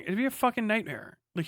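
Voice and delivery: ordinary talk, nothing marked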